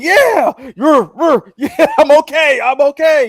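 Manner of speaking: high, loud hammed-up hero shouts; masked Punisher posing. Retelling Frank faking Spider-Man in his mask, laughing shouts. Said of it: "it's Frank in there with the Spider-Man mask on".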